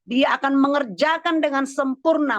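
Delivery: high, impassioned preacher's exhortation; fervent preaching. Real, not acted. Pastor urging congregation to bring all to Jesus, fervent preaching. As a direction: lift the voice and urge them to surrender it.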